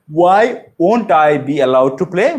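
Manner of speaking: very slow teaching question, rising at the end; model sentence. Shown as a demonstration. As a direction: demonstrate slowly for learners to copy. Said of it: "এরকম করে বলতে পারি". (ধীরে ধীরে শেখানোর ভঙ্গিতে প্রশ্ন)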